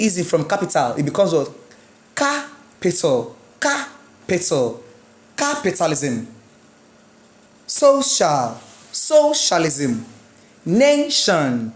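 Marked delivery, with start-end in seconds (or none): 2.1-6.3 slow "Capital", "Capitalism", CA held apart; teacher stretching the CA in "Capital" and "Capitalism"; stress on first syllable. Shown as a demonstration. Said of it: "rules for syllabic stress". 7.7-11.7 "Social", "Socialism", "Nation" with long, strong first syllable; stress on first syllable. Shown as a demonstration. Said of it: "rules for syllabic stress".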